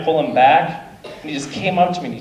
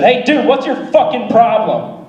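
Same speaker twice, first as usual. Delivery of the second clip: mock angry yell; 8 semitones higher, 3 dB louder than usual. Drunk guy yelling from lawn.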